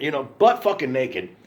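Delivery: ordinary talk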